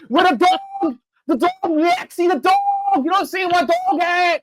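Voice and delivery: put-on high, slow, yelling Boston accent. Superman yelling at Lex in a Boston accent. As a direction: yell for the dog like a Boston guy. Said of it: "I wish Superman said that in a Boston accent".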